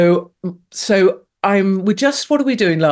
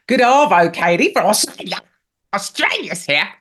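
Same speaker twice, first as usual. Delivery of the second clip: a hammed-up Australian accent; mock Australian accent; 3 semitones higher than usual. Host reads an Australian listener's greeting in mock Australian accent. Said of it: "Australians don't speak like that".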